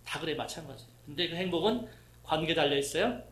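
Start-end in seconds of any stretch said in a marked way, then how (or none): none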